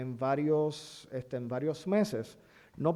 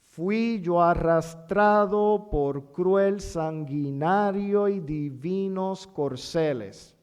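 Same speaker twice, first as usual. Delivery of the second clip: slow, louder reading of a hexameter line; verse recitation; 5 dB louder, slower than usual. Shown as a demonstration. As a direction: declaim the verse, letting the rhythm carry. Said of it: "Un ejemplo". (recitación pausada y rítmica del verso)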